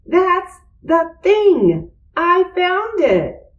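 excited scream. A narrator's reading. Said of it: "screamed Arthur".

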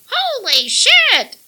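very high, loud, slow squawk; parrot screech. A narrator's reading. Parrot screeching in the room, loud. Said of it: "the bird screeched".

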